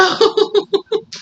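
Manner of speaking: high delighted giggle; giddy high laugh. Real, not acted. Reader laughing with delight at her viewers' reading. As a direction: laugh out of pure joy.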